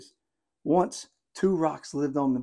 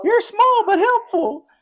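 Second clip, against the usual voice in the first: tiny crab voice; 7 dB louder than usual. Acted. Voicing the crab character.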